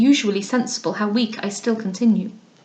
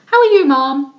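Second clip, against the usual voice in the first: high, louder, affected greeting; languid young lady; 4 semitones higher, 6 dB louder than usual. Acted. Spoilt young lady greets her hostess, affectedly. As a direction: toss off a careless hello as a spoilt lady.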